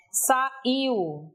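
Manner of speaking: "saiu" split into sa-IU; syllables separated. Shown as a demonstration. Teacher showing the tonic I, syllables separated. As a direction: say "saiu" in two parts, stress on the I. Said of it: "também não acentuo esse I".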